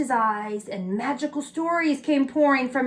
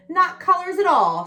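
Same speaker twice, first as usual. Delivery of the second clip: sniffed; 6 semitones higher, 5 dB louder than usual. A narrator's reading. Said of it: "sniffed the dotty red".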